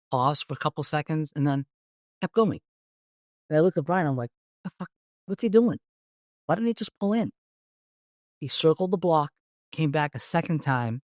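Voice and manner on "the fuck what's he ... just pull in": higher, puzzled mock outburst; baffled disbelief. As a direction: retell the confusion, pitched up. Puzzled cop watching suspect's car.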